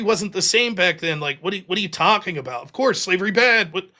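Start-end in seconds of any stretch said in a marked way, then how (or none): none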